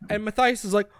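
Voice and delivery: ordinary talk, nothing marked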